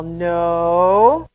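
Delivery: a firm loud mom's no; loud warning no to a toddler; stern no. Retold or imitated. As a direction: say no sharply to stop the baby. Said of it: "she's all".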